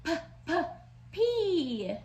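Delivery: "P" said as repeated popped p; P sound. Shown as a demonstration. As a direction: pop "P" crisply. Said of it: "That's a fun sound".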